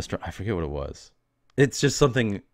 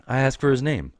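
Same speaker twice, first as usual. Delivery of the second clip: ordinary talk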